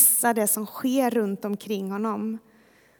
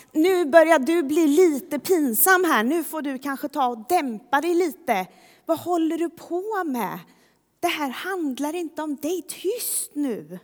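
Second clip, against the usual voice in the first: higher-pitched, embarrassed telling-off; hushing reproof; 5 semitones higher than usual. Acted. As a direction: scold someone into toning it down. Crowd scolding the embarrassing beggar.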